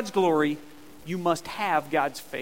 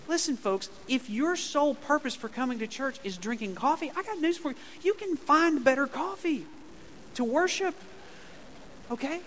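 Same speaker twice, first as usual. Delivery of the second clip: high, pushing preacher's rebuke; fired-up rebuke; 7 semitones higher than usual. Real, not acted. Pastor scolds the congregation about coffee, raised high. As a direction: lean in and scold the coffee crowd.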